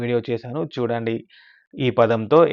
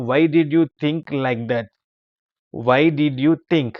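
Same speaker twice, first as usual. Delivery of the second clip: slow model reading; 2 semitones higher, much slower than usual. Shown as a demonstration. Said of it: "teacher models English sentence slowly".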